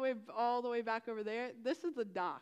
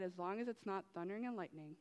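ironic; 4 semitones lower, 7 dB quieter than usual. Retold or imitated. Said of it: "what happens?".

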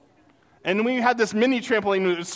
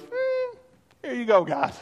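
a high bored "Mm", then a careless offering; dismissive shrug; 3 semitones higher than usual. Pretend. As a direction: hand it over like it means nothing. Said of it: "Cain goes".

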